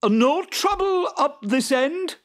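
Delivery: high and loud across the field; calling out. Acted. Jude calling back across the field, loud. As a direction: call back cheerfully from afar. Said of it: "called Jude".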